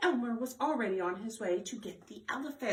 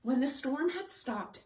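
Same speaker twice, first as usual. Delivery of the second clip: plain reading voice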